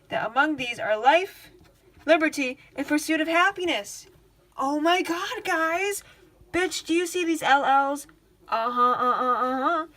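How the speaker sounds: loud, high-pitched excitement; really hyped up. Real, not acted. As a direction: burst out loud at a thrilling discovery. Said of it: "it gets me really hyped up".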